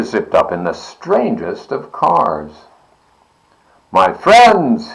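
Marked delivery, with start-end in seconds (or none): 3.9-4.9 a ringing salesman's call; clear and keen. A narrator's reading. Stranger greeting the plain-bellied Sneetches, clear and keen. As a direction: announce it clear and keen to win them over. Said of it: "he announced in a voice clear and keen".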